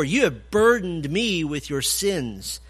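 slow, weighing "burdened"; slow stressed quote. Shown as a demonstration. Preacher pressing the key word, slow and heavy. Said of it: "theme of burdened".

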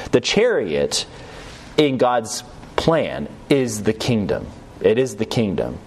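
ordinary talk, nothing marked

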